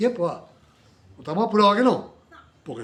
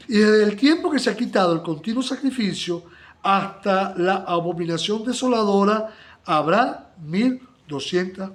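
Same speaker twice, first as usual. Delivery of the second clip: slow, weighty, louder reading of the verse; solemn scripture reading; 3 semitones higher, 5 dB louder, slower than usual. Acted. Preacher reading Daniel's verse aloud to the congregation, slow and solemn. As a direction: lay out each word of the prophecy deliberately.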